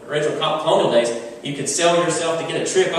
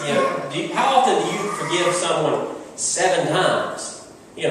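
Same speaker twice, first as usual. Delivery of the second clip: ordinary talk, nothing marked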